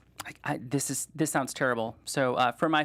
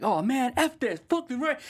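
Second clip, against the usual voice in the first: mock frustrated dude; 10 semitones higher than usual. Dude gives up, storms off.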